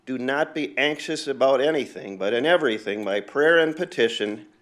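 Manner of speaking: loud, fervent reading of Philippians; scripture recitation. Acted. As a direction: proclaim each line to the congregation.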